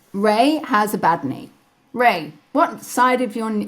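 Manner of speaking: ordinary talk, nothing marked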